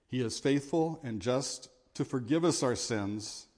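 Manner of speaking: ordinary talk, nothing marked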